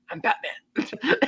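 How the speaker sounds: Michael Keaton leans over to Peter, whispered Batman; hushed, drawn-out whisper. Retold or imitated. Line from the car scene, slow imitation whisper. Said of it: "he's like".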